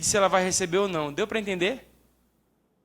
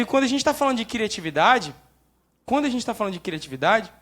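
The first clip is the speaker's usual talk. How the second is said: usual speaking voice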